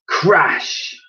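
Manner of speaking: loud high crash; narrated crash with a bang; crash sound. A narrator's reading. Sound effect.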